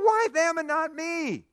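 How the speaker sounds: high, loud whining why them and not me; whiny complaint. Retold or imitated. Believer whining to God about the neighbor, high and loud. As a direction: whine at God for being left out. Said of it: "we go".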